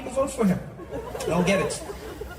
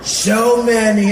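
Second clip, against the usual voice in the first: slow and loud, kept low in pitch; Donald Trump; 7 dB louder, much slower than usual. Acted. President mid-speech, slow, lower-set drawn-out impression. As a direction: stretch SO MANY out without lifting the pitch. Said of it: "it was low".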